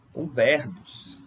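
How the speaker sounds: ordinary delivery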